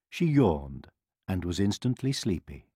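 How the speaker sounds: plain reading voice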